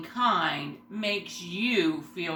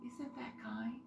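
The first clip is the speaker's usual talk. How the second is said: whispering; 14 dB quieter than usual. Real, not acted. Reading picture book to kids.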